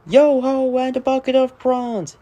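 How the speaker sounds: put-on high hearty old sea dog calling out; sing-song shanty refrain, hearty; salty sailor voice. Narrator voicing Salty the dock diesel, hearty old sailor.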